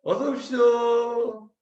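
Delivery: high, slow, sing-song greeter; smiling welcome. Retold or imitated. Gate soldiers to new recruits, smiling sing-song welcome. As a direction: imitate kindly soldiers luring recruits in with a smile. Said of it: "웃으면서 우리한테 얘기해".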